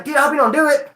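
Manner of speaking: mock-anxious plea to a friend; stressing. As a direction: act out stressing over it. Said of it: "So now I'm stressing".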